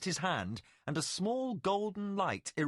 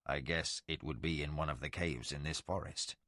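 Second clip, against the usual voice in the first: much lower, softer, solemn voice; ancient Valheru lord; 10 semitones lower, 5 dB quieter than usual. Acted. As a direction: speak as a legend come to life. Tomas muses.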